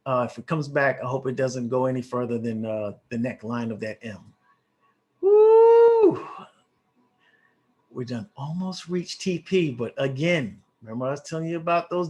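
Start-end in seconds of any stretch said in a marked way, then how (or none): 5.2-6.2 long, high, loud "woo"; excited whoop. Real, not acted. Trader cheering his trade back in profit, excited whoop.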